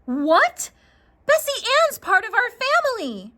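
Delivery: put-on high, loud disbelieving child; shocked protest. Jackie protesting to her mom about selling Bessie, high and shocked. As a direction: cry out against losing the cow.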